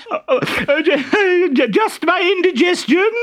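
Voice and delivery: very high, loud simpering falsetto; disguised Harlequin. Acted. Harlequin in a dress flirting with the captain, high simpering falsetto. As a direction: gush like an ugly coy bride.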